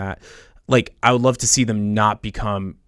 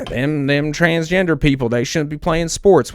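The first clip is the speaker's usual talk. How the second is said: mock Southern accent; 6 semitones higher, 4 dB louder than usual. Quoting bigoted voters.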